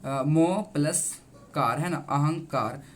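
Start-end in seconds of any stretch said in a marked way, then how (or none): 0.1-1.8 very slow, parts मो plus कार spaced apart; teacher slowly splitting the word for pupils; slow breakdown. Shown as a demonstration. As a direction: lay the pieces out one by one, very slowly. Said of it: "संधि विच्छेद करते हैं".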